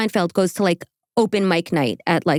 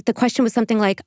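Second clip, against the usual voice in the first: ordinary talk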